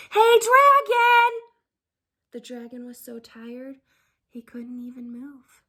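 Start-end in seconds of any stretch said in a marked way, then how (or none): 0.1-1.3 slow, high, very loud yell; shouting as loud as possible. Acted. Princess yells into the sleeping dragon's ear, full shout. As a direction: bellow it right into his ear. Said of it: "shouted as loud as she could".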